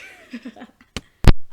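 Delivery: high, loud shrieking cackle; shrieking laugh. Real, not acted. Reviewer cracking up at own joke, shrieking laugh. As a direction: burst into a shrill shriek of laughter.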